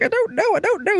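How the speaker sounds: very high squeaky denial; mock panicked denial. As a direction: plead ignorance in a put-on falsetto. Voicing man under questioning.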